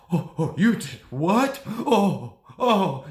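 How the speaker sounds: loud, high, slow mock sobs; hammed-up crying with no tears; fake crying. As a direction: imitate a narcissist's badly faked cry. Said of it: "fake crying".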